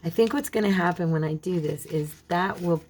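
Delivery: ordinary talk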